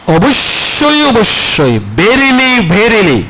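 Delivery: "অবশ্যই" and "verily" swung high, then falling; emphatic. Real, not acted. Preacher translating an emphatic word, "অবশ্যই" and "verily" insistently stressed. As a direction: hammer each repeated "অবশ্যই", "verily" with a stretched pitch swoop.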